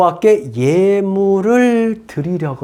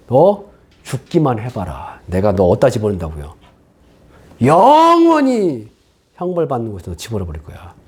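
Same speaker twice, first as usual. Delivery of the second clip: menacing God; 7 semitones lower than usual. Acted. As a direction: threaten like a God sharpening a knife. God threatening sinner. (낮고 위협적인 하나님 목소리)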